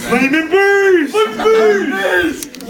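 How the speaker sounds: high, drawn-out creature call; monster's battle cry. Acted. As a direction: call it out slowly, hammed up. Voices approaching monster's cry.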